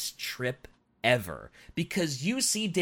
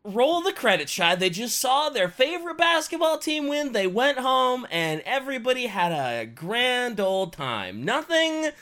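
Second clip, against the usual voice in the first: mock cheerful; 8 semitones higher, 5 dB louder than usual. Continues fake happy ending.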